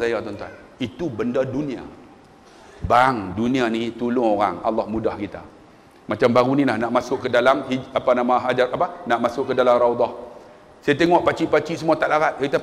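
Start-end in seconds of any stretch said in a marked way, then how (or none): none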